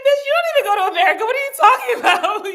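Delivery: loud, high, fast comic scolding; mock indignation. Imagined viewer scolding the streamer, mock indignant. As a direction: rattle it off in mock outrage. Acted scolding comeback to herself.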